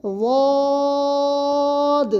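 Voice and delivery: واد held long on a steady tone; long recitation واد; recited letter. Shown as a demonstration. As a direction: chant واد very slowly. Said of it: "بلکہ".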